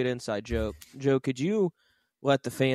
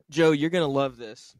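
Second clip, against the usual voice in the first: ordinary talk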